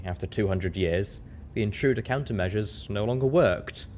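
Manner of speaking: ordinary delivery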